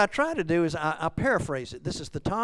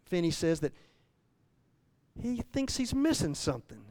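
ordinary delivery

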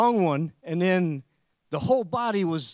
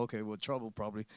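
muttered aside; 9 semitones lower, 13 dB quieter, faster than usual. Retold or imitated.

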